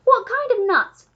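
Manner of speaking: high, loud, drawn-out question; eager monkey demanding to know; excited cry. A narrator's reading. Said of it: "cried the monkey".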